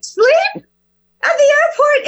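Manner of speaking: very high, rising thrilled squeal; daughter's squeal of joy. Retold or imitated. Daughter repeating the airport plan, squealing very high with joy. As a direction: shriek it like the best news ever. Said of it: "she's like".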